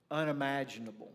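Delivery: ordinary talk, nothing marked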